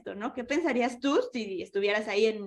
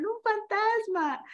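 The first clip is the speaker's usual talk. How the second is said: higher, slow mock fright; frightened disciples; 11 semitones higher, much slower than usual. Teacher voicing scared disciples, mock fright. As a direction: act out the startled disciples seeing a ghost. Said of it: "Y dijeron".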